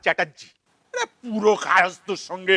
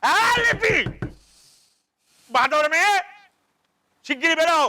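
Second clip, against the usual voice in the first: shrieks high, then keeps shouting higher; yelling at daughter; 6 semitones higher than usual. Acted. Father yells at his daughter locked in the bathroom, high and shouting. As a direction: scold her through the door, pitched way up.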